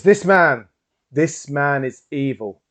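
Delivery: loud, high accusing cry, slowing on the repeat; dramatic accusation. Acted. Entranced man points out a random diner, loud accusation. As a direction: denounce him for the whole room to hear. Hypnotised accusation before a crowd.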